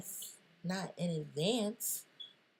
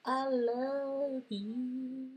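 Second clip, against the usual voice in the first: drawn-out declaration; 6 semitones higher, much slower than usual. Acted.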